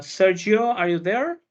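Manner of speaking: usual speaking voice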